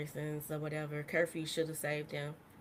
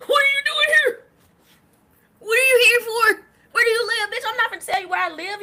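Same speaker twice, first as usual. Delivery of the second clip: shrill, much higher, much louder mock confrontation; mock Karen shout; 13 semitones higher, 12 dB louder than usual. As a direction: shout it like a nosy Karen then snap back. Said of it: "Karens ... going out".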